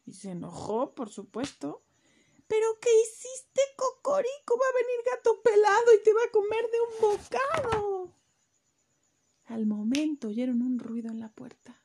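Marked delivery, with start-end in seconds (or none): 2.5-8.1 high, loud angry scolding; scolding hen. Acted. Mother hen scolding the chick, high and loud. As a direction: tell him off like a furious mother hen.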